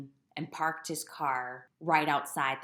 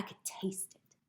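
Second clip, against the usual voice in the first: whispered disgust; 4 semitones higher, 7 dB quieter than usual. Real, not acted. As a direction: whisper it, grossed out. Disgusted confiding about medicine.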